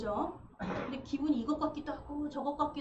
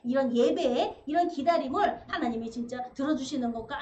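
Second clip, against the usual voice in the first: ordinary talk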